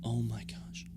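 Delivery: breathless whisper of disbelief; stunned whisper. Real, not acted. Host whispering in awe at the final two. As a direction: barely breathe it out.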